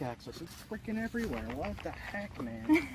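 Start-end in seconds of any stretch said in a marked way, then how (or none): none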